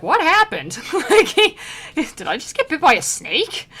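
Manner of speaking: high, loud, bewildered voice; confused guy voice. Retold or imitated. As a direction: play a baffled sinner, loud and high. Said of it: "that guy was like".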